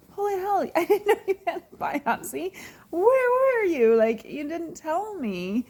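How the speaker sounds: a friend kept a biopsy secret, laughing disbelief; shocked disbelief. Retold or imitated. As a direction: replay the moment it hit you. Retelling her shock at a friend.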